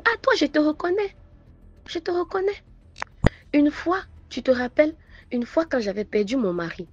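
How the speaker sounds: light up at a familiar face; excited recognition. Retold or imitated. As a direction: stranger excitedly recognizing the mother. Stranger recognizes mother excitedly.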